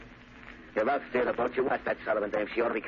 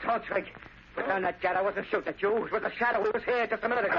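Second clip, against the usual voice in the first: much higher, frantic pleading; desperate pleading; 10 semitones higher than usual. Acted. Rankin begging armed Trigger not to shoot, frantic. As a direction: beg for your life.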